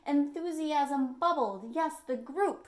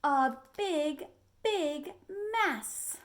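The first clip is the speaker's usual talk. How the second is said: dramatic refrain; 5 semitones higher, slower than usual. A narrator's reading. Refrain read dramatically to children.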